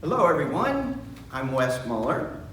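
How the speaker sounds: ordinary delivery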